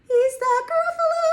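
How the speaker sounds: sung very high and loud; high sung climax. Shown as a demonstration. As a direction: hit the top note on Gruffalo. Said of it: "It got higher at the end".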